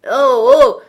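very loud, high hearty holler; cried out. A narrator's reading. Fezziwig hailing his apprentices from the desk, loud cry. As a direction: bellow it jovially across the shop. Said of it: "s'écria le vieux Fezziwig".

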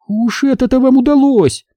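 high-pitched exclamation; high, pointed retort; exclaiming. A narrator's reading. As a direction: exclaim it high, as the story's character. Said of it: "воскликнула Минди".

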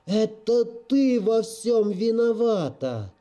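high, slow, desperate cry of grief; anguished shout. Acted. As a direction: wail the blame out in anguish. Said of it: "отчаянный, полный тоски и боли крик".

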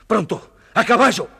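high, loud order to hurry; hurried rallying shout; urgent command. Acted. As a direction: bark the call to ride. Caliph orders hasty departure.

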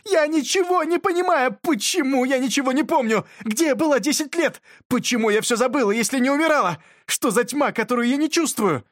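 high, loud, panicked shouting; distressed shouting. A narrator's reading. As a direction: cry out, overwhelmed and confused. Beska screams in confusion.

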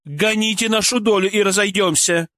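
высокое злое требование; требовать резко, высоким голосом; зло огрызаясь. Acted. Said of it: "зло огрызнулась бывшая продавщица". (зло, требовательно)

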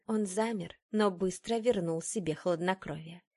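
plain reading voice

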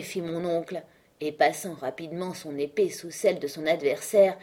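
ordinary delivery

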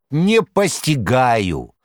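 high, slow, drawn-out cry of bafflement; exclaiming. A narrator's reading. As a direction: throw up your hands and cry it out. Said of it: "Бегемот вскричал".

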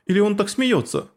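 usual speaking voice

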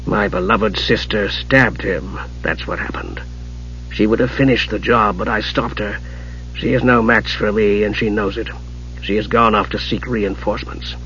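low, very soft sorcerer's voice; cold, quiet Archmage. A narrator's reading. Raistlin telling the kender who stabbed Palin, coldly quiet. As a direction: explain it tersely, low and hushed. Mage's held voice.

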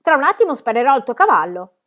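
loud threat; threatening shout. A narrator's reading. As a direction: bark the threat at her. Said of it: "gridò".

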